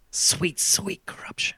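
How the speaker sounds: slow whispered relish; mock relish. Joking podcaster savouring British money laundering in a whisper. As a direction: whisper it like a delicious secret.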